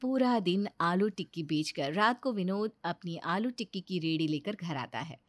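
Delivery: ordinary delivery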